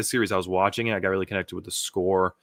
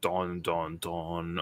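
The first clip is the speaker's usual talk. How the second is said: low, softer sung-out "dun dun dun"; dramatic sting; 5 semitones lower, 10 dB quieter, much slower than usual. Acted. Reader capping the cliffhanger, mock dramatic sting. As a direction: intone a mock suspense sting, falling away.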